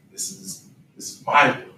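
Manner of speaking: ordinary talk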